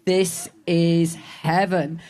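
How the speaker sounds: slow, dreamy voiced thought; blissful inner voice. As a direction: savour each word like bliss. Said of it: "It's thinking".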